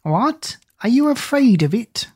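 put-on high, coaxing old crone; old woman crying out. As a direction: tempt her with a high cry. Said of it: "cried the old woman".

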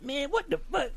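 put-on high raspy old-school guy; Larry. Teller imitates his raspy coworker reacting, high exasperated rasp. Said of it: "that's exactly how he talked".

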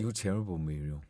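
low, hushed sly jibe; softly mocking film quote. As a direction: imitate a sly put-down under the breath.

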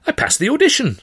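high, bright and proud; beaming. Acted. Girl proudly telling her boyfriend her news, beaming. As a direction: announce it with a big smile. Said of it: "she beamed".

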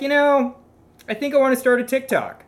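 playful mock musing; mock millennial voice. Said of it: "we'll be like".

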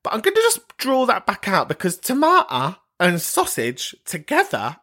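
ordinary delivery

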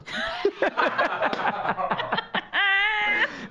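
high wheezing laugh; long breathless cackle; helpless laughter. Real, not acted. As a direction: crack up at your own punchline. Laughing at own punchline.

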